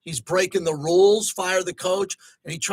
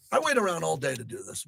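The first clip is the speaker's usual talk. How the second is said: ordinary delivery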